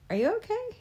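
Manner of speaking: stunned high-pitched are you okay; high, incredulous. Shown as a demonstration. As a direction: voice jumps high in surprise. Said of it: "genuine surprise".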